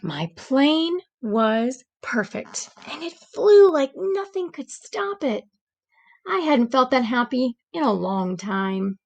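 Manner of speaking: gentle read-aloud storytelling voice; story narration. A narrator's reading. Teacher reading a storybook to the class, gentle narration. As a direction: read it like a bedtime picture book.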